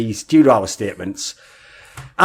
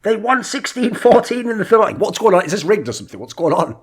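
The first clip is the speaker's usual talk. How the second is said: acted disbelief, pitched up; baffled, higher-voiced outburst; incredulous; 7 semitones higher than usual. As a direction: react like the score must be rigged. Acting out shocked reaction to score.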